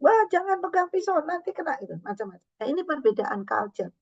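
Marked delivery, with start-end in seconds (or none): 0.0-1.8 higher-pitched mock yell of a worried mother; half-hearted imitation of a mother shouting a warning. Mother warning a small child holding a knife, mild mock yell. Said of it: "emaknya teriak-teriak kan".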